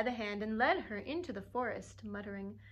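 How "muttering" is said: usual speaking voice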